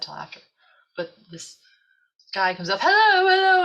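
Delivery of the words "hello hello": high, loud English hello; English accent. Retold or imitated. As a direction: call out a hammed-up English greeting. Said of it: "in this English accent".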